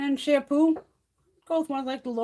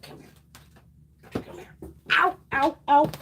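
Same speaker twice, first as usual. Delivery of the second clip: ordinary delivery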